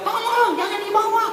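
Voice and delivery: very high frightened pleading; screaming not to be carried away; hammed-up terrified cry. Teriak, dia teriak.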